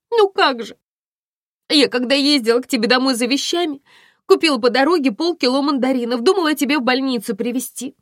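high, loud wailing account; tearful confession. A narrator's reading. Violetta confesses to Aglaya by phone, tearful. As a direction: pour it out high and loud, near sobs. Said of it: "Виолетта зарыдала в трубку".

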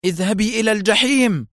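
high-pitched fierce cry; savage fury. A narrator's reading. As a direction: cry it out ferociously, pitched well up. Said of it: "هتفت راشيل بالعبرية في وحشية شرسة".

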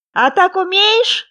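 high, loud, drawn-out challenge; playful hare calling out. A narrator's reading. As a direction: shout it to a playmate, stretching it out. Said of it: "кому-то кричал он".

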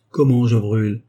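sharp alarmed reply. Acted. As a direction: jump up in alarm. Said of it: "fit vivement la vieille fille". (la vieille fille réplique vivement, effrayée)

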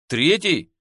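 plain reading voice

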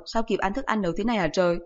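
ordinary delivery

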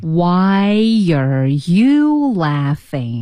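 非常慢，"you" 重读后降调; 拖长语速，压在 "you" 上. Shown as a demonstration. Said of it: "跟着老师把这种感觉读出来". (老师领读，放慢并重读 "you")

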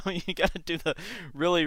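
ordinary talk

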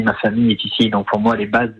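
ordinary talk, nothing marked

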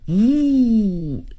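long drawn-out "woo" whoosh; imitated weapon whoosh. Lance swung at Luo Cheng's head, imitated whoosh. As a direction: voice the lance swinging down.

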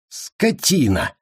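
slow, high outburst; angry curse. A narrator's reading. Bound commander curses his captor, enraged. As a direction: spit the insult, drawn out and high.

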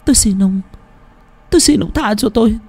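put-on high-pitched pleading girl; imploring. A narrator's reading. Girl begging for her family's sake, high imploring voice. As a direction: beg for mercy in a high, halting voice. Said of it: "Cô gái vẫn cứ khẩn khoản".

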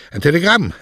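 high, loud announcement; calling out. Acted. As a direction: call it out across the rocks. Calling to poet across the rocks.

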